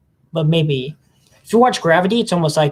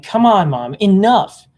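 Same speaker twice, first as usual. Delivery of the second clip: mock exasperation; 4 semitones higher, 6 dB louder, slower than usual. Exasperated reply to mom.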